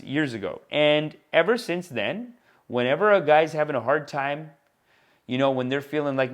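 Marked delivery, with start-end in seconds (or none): none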